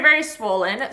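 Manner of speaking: ordinary delivery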